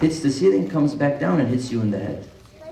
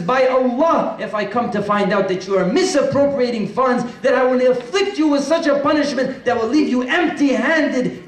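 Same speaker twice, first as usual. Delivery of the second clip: higher and louder, a forceful oath of punishment; stern warning; 10 semitones higher, 3 dB louder than usual. Acted. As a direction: swear it with rising force. Ali's stern written threat to governor.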